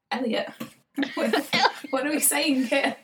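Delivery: high-pitched incredulous retelling with laughs; laughing disbelief. Retold or imitated. Retelling a reply to brother about Sean Bean, laughing disbelief. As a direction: question it through helpless amusement.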